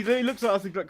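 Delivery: ordinary talk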